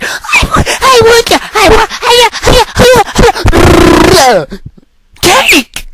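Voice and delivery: put-on squeaky, high crying wail of a spoiled child; whiny begging kid. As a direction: shriek it like a kid throwing a tantrum.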